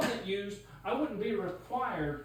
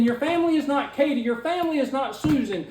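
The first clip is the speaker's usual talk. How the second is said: loud, fast and heated; 7 dB louder than usual. Real, not acted. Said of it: "heated argument".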